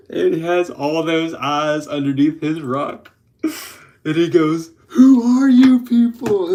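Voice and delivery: giggling through the retelling; laughing while telling the Patrick scene. Retold or imitated. Telling a SpongeBob scene to viewers, laughing.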